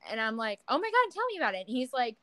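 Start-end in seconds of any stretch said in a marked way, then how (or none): 0.7-1.7 retold eager reply, higher and quick. Friend urging friend to tell the Uber story, eager. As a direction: play up mild excitement, pitched high.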